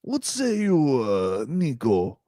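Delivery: put-on slow drawn-out Serbian accent; lightly playing a Serbian reporter. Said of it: "when you want me to do it, the accent".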